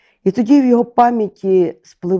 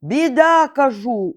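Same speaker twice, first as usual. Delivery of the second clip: crow shouting; 7 semitones higher than usual. A narrator's reading. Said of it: "уже кричав Ворон".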